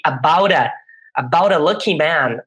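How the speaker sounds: "About a" with a flapped t, run together as abawɾ ɐ; pronunciation demo. Shown as a demonstration. Teacher to class, showing "about a" with a flapped t. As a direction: link "about a" smoothly, t turned to a quick r tap. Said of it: "Agora presta atenção nesta pronúncia aqui".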